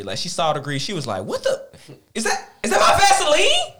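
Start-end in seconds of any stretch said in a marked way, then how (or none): 1.2-3.7 high, louder outraged mom; angry mom. Retold or imitated. Mom seeing her Vaseline all over the house, outraged. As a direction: hit it like a furious mother spotting her stuff. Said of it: "but she was more angry".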